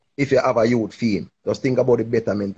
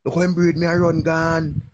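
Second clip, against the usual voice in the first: mock wailing mother; 7 semitones higher than usual. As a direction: ham up a grieving mother's lament. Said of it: "Nobody cry and say".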